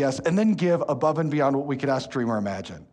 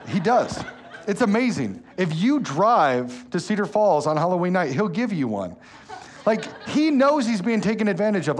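laughing; 6 semitones higher, 5 dB louder than usual. Real, not acted. Laughing about king-size candy.

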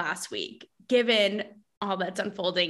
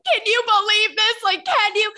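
high, loud, disbelieving retold cry; incredulous excitement; 9 semitones higher, 4 dB louder than usual. Retelling a call to her best friend, excited disbelief. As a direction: gush in amazement, pitched way up.

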